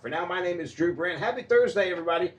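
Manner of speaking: big cheerful on-air sign-off; radio host sign-off. Acted.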